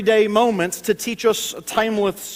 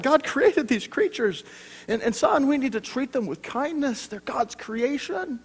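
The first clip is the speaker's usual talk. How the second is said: gentle dad; 6 semitones higher, 4 dB quieter than usual. Retold or imitated. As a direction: ease into it like a patient dad. Tender father talk to toddler.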